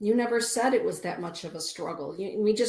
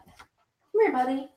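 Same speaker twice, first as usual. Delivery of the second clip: high, louder coaxing call; high-pitched talk to an animal buddy; pet-talk; 7 semitones higher, 6 dB louder, slower than usual. Real, not acted. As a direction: call a little pet over. Calling the pet Merlin to them.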